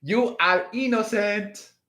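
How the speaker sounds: playful mock Spanish accent; joking Spanish accent. Shown as a demonstration. Reassuring Aquarius in a mock Spanish accent. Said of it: "in a Spanish accent?".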